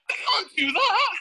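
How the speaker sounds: high, slow, strained wail; drawn-out high-pitched protest; mock wail. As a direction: wail it out in hammed-up despair. Acts out a frustrated outburst.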